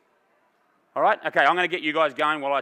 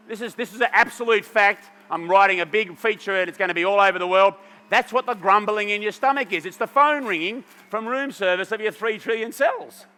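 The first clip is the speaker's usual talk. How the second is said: normal talking voice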